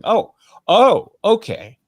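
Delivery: a high, loud "oh, oh" then an easing "okay"; mock realization. Outraged viewer finally seeing the content, a sudden surprised realization. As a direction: play the viewer who suddenly gets it. Viewer reconsidering after watching.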